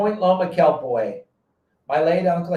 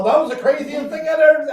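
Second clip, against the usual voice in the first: high, excited gush; thrilled fan; 10 semitones higher, 5 dB louder than usual. Retold or imitated. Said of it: "And they're all".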